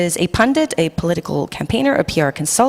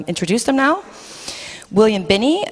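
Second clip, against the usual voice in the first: ordinary delivery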